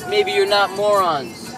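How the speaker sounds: normal talking voice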